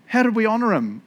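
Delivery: high-pitched mock astonishment; king's shocked realisation. King who has just heard Mordecai saved his life, shocked. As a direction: gasp it out as a flustered, high-voiced king. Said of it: "So the king's literally just heard".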